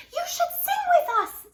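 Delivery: very high, loud singsong; chirpy birds. Acted. Birds inviting Jim to sing, very high chirping.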